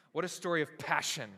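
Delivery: ordinary talk, nothing marked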